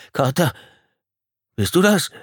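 high questioning call to a friend. A narrator's reading. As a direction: call anxiously through the dark. Friend calls through dark cabin.

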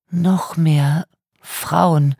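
faint, drawn-out and exhausted; weak voice. A narrator's reading. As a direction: barely get the words out, very slowly. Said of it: "mit schwacher Stimme".

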